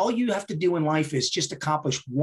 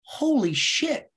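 high, slow, drawn-out amazement; stunned realisation; 10 semitones higher, much slower than usual. Retold or imitated. Reacting to the boss's advice, awestruck and slow. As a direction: stretch it out as if floored.

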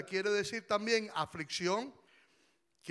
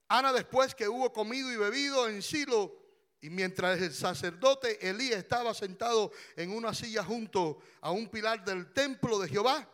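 usual speaking voice

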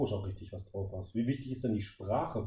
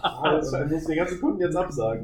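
louder and higher through laughter; laughing; 6 semitones higher, 9 dB louder than usual. Real, not acted. Host laughs back at the guest's joke, laughing.

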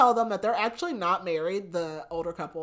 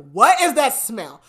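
loud, slow, higher-pitched complaint; mock exasperated exclamation; 5 semitones higher, 12 dB louder, slower than usual. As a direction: demand it loudly, stretching each word. Retold character reacts to smell.

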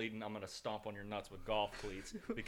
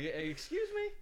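much higher mock indignant squeak; mock offended. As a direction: play the offended one, high. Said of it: "I'm like".

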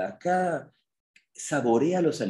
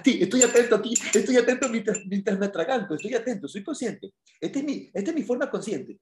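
mocking rushed person; 9 semitones higher than usual. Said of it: "eso es lo que la gente dice".

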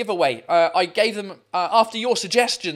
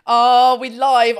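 excited; 2 semitones higher, 8 dB louder, slower than usual. Real, not acted. Show opening, calling out.

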